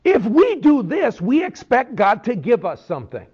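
high, eager "of course" answer, mock-naive; congregation answering. Preacher voicing the congregation's answer to Prager, mock-eager. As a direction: play the whole congregation expecting a reward. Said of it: "The whole place said".

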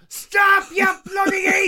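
high, loud scolding shout; acted parental yell. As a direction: yell to stop a child in danger. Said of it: "I'm gonna be going".

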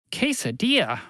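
bewildered high exclamation; exclaiming. A narrator's reading. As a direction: blurt it out with pitch jumping up. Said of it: "Fred exclaimed".

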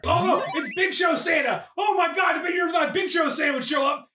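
excited wrestling commentary; wrestling announcer hype. Pretend. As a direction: hype up the big entrance like a wrestling caller. Hyping Big Show Santa entrance.

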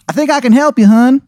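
very high, badly faked Maryland accent; a hammed-up Maryland accent that can't land; terrible Maryland accent. Game master voicing Shithead Steve to Aaron, awful Maryland accent. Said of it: "the worst Maryland accent you have ever heard".

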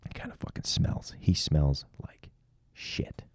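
full whisper, secretive. Real, not acted. Gossiping about his nearby friend in a whisper. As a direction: breathe it so he won't hear.

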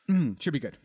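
throat just cleared, slightly strained; recovering from choking, a bit strained; clearing throat. Real, not acted. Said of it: "Clear it out, man".

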